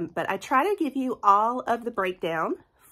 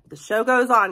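upbeat; 3 semitones higher, 8 dB louder than usual. Real, not acted. Upbeat rallying despite her cold.

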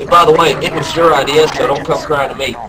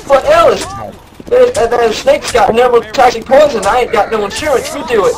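higher, mock whining plea; 9 semitones higher, 3 dB louder than usual. Keith whining to Ellis to catch the snake, mock whine. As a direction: beg your way out of it like a coward. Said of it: "he was whining and shit".

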